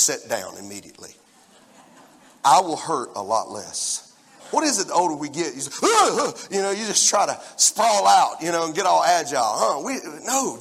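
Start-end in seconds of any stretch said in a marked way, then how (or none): 5.8-6.4 loud, high "ugh" noises; imitated flailing groan. Older man slipping on ice, loud mock groans. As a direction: grunt high as if losing your footing.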